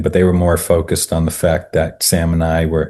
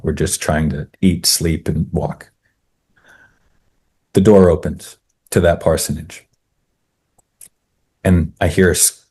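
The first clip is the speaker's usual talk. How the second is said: ordinary delivery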